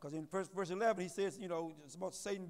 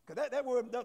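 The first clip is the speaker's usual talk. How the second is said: ordinary talk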